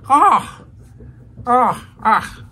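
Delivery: high, loud mock shrieks of disgust; disgusted cries. Alfred recoiling from the slobbering Moondog, high disgusted shrieks. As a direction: shriek in horror like a prim butler. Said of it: "And Alfred is disgusted".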